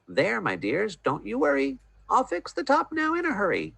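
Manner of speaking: high, soothing reassurance; calm the little ones; reassuring. A narrator's reading. Fox reassures family.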